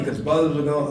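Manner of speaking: normal talking voice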